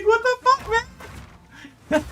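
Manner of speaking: very high squeaky what the fuck; mock outrage. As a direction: squeal in fake hurt. Outraged reaction to game insult.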